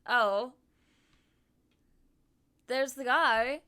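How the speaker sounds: excited. Retold or imitated. Excited spotting someone.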